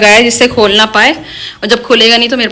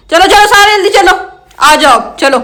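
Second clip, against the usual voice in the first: calling out; 8 semitones higher, 4 dB louder than usual. Real, not acted. Calling birds along.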